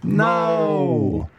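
long, falling wail of "no"; dismayed no. Real, not acted. Host to co-hosts after the call, dismayed.

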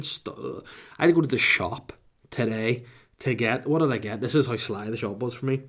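usual speaking voice

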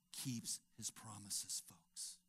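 slow, soft, trailing to a whisper; hushed. Real, not acted. Preacher telling his congregation softly, slow and hushed. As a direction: let it sink in, hushed.

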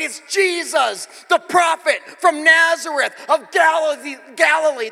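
high, loud slow shout of the name; hammed-up joyful crowd yell. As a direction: bellow it like the cheering crowd. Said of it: "the crowds replied".

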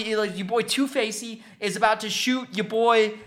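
usual speaking voice

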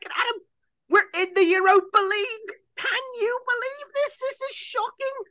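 very high, wailing Liverpool pundit; hammed-up Scouse disbelief, squealed; Jamie Carragher. Pundit telling Adam Liverpool are in Europa League, squealed Scouse lament. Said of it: "It's the Jamie Carragher one".

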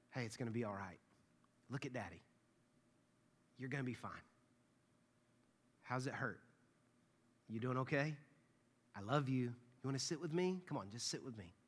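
low, hushed soothing parent; calm a hurt child very softly; extremely soft. Acted. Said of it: "I talk extremely softer than them".

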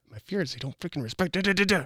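acted inner grumbling that climbs at the end; frustrated muttered rant. Shown as a demonstration. Coach voices parent's inner fear, frustrated grumble. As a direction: grumble fast-building frustration, trailing into nonsense syllables.

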